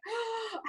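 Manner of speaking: high, soft acted gasp; re-enacted gasp of embarrassment; mortified gasp. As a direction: suck in a mock-horrified breath. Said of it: "I was fucking mortified".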